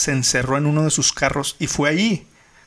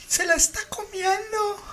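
high, sobbing panicked report; crying panic; slower than usual. Retold or imitated. Caller describing the attack to the operator, crying. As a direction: imitate a hysterical caller crying. Retold frantic 911 call, crying.

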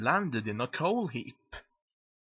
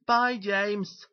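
high, louder sing-out goodbye; calling out; 8 semitones higher, 5 dB louder than usual. Acted. Thomas calling to James as he races past, cheery shout.

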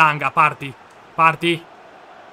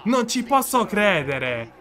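ordinary talk

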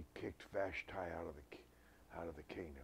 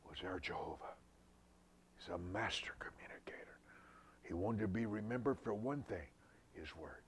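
usual speaking voice

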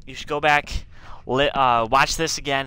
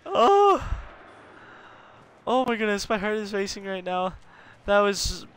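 shaken laughing; 5 semitones higher than usual. Real, not acted. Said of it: "I'm crying because of how bad I got scared".

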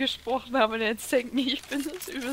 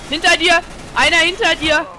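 shouted warning; urgent shout; 7 semitones higher, 10 dB louder than usual. Real, not acted. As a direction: yell a warning to a teammate. Teammate warns of enemy behind.

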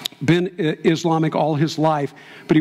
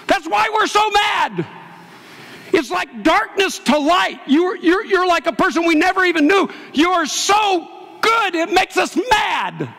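retold furious shout. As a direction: yell it back as the enraged attackers. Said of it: "they said".

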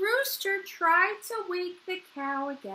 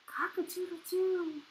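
weak, soft crow; a feeble attempt at crowing; sore-throat rooster; 7 dB quieter than usual. A narrator's reading. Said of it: "So here goes the rooster".

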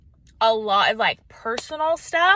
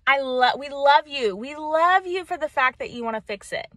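usual speaking voice

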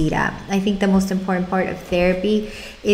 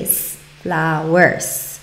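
slow, S sounded alone then "flowers"; model the S; 3 semitones lower, much slower than usual. Shown as a demonstration. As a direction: prompt with a lone S before "flowers". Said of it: "you want the child to say".

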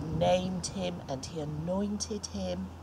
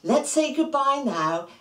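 ordinary delivery

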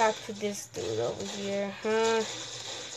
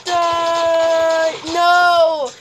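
loud, high shout in the fight; battle yell; 6 semitones higher, 9 dB louder than usual. Real, not acted. Kid gamer yelling during shootout, loud.

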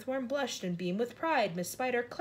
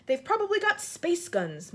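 usual speaking voice

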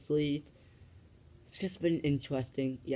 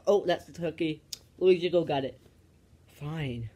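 normal talking voice

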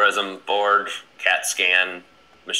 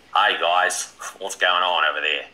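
Australian, as if chewing on the words; Australian accent; 2 semitones lower than usual. Shown as a demonstration. Demonstrating his trick for a mock Australian accent. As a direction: fake an Australian accent by pretending to eat. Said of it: "trying to do an Australian accent".

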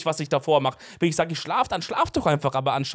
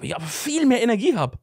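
ordinary delivery

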